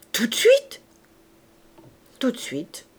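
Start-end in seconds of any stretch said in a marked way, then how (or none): 0.1-0.8 loud, eager, sharply rising query; amazed question. Acted. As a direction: TOUT DE SUITE shooting up high. Innkeeper amazed, asks if right now.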